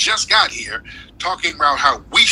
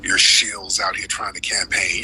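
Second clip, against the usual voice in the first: normal talking voice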